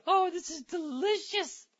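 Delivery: very high, slow squeal of relish; drawn-out delighted falsetto; gleeful delight. Real, not acted. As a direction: savor it in a high, loud squeak. Gleeful reaction to the article.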